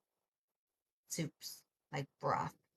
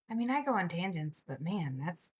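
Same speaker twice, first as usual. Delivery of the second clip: usual speaking voice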